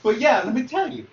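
high, loud sales pitch voice; imitating a slick pitchman; sleazy salesman. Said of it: "he puts on a salesman voice".

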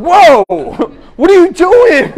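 startled, laughing. Retold or imitated. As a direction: jump back in shock, half-laughing. Boy suddenly shaking uncontrollably.

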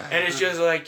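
usual speaking voice

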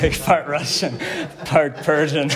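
amused, giggly aside about heritage; laughing. Real, not acted. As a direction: chuckle it out as a joke. Joking repeat with laughter.

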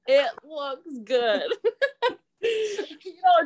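slow, laughing reassurance. Real, not acted. Photographer reassuring a puzzled couple, laughing. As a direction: break up laughing as you say it. Laughing at own awkward directions.

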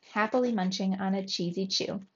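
plain reading voice

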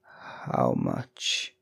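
barely voiced, horror-struck question; dry-lipped. A narrator's reading. Father to company's visitor, a horrified near whisper. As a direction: force it out through dry lips, almost soundless. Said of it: "His dry lips shaped the words".

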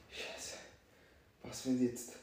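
low, soft inner voice; hushed fearful thought. Retold or imitated. Storyteller's fearful thought under the blanket, hushed. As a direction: think it aloud softly, dreading.

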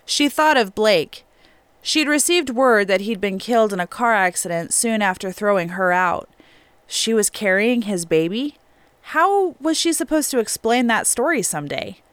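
ordinary delivery

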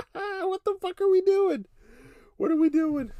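very high, loud incredulous yell; shrieking disbelief. Real, not acted. Podcaster baffled by the caller, high incredulous yell. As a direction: scream it in disbelief.

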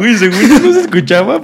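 high, loud laughing; laughing it off loudly; embarrassed laugh. Real, not acted. Embarrassed reply to burp teasing. (riendo avergonzado)

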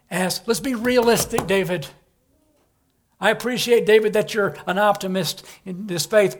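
loud, high, pushing voice; the tempter loudly pressing David; tempting voice. Acted. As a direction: needle him to give up hope. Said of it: "He says it this way".

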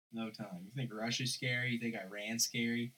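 usual speaking voice

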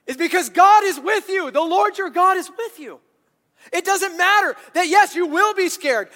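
rousing preacher; full-force preaching. Acted. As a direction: lift the room with every line.

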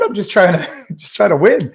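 sheepish chuckle as you answer; laughing it off. Retold or imitated. Telling his coach he just wants to win, laughing.

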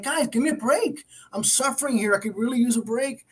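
high, pleading voice of a fed-up Job; a fed-up sufferer pleading, lifted high; exasperated Job. Retold or imitated. Job pleading with his friends.

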